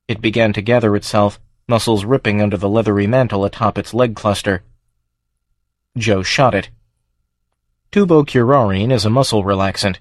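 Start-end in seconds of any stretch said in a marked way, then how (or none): none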